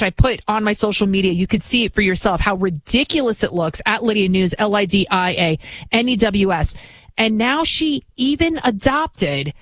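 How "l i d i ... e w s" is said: ordinary delivery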